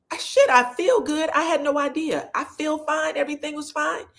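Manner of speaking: high, carefree brushing off; unbothered husband. Retold or imitated. Husband brushing off the stroke warning, high carefree tone. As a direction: shrug it off, high and easy. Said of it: "Von said".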